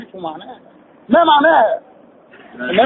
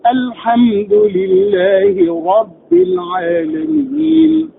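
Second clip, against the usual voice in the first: very slow melodic recitation, higher pitch; slow Quran recitation; 5 semitones higher, much slower than usual. Shown as a demonstration. Teacher recites the verse as a tajweed model. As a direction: chant it slowly like a reciter. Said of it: "تطبيق عملي".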